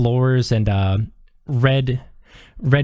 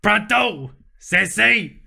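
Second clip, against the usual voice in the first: higher, brassy diner call; a lot higher, calling the order; high waitress call; 6 semitones higher than usual. Acted. Waitress calls the order to her coworker, much higher.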